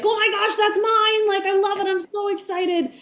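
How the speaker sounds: imitating a thrilled fan in high, loud voice; excited fan. Imitating fans in chat seeing their item get signed, excitedly. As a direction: gush like a delighted chat member.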